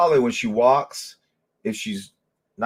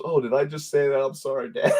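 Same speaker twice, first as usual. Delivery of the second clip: imitated daughter's apology; 5 semitones higher, faster than usual. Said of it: "And she goes".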